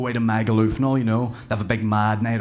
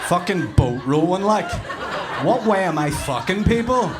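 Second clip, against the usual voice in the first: higher, louder, laughing; cracking up in disbelief; laughing outrage; 8 semitones higher, 4 dB louder than usual. Real, not acted. Comic mock-outraged at his Fitbit, laughing.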